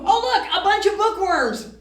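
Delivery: high, loud, laughing delight; delighted puppet. Acted. Bookworm puppet thrilled to see children. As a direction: squeal with joy at the helpers.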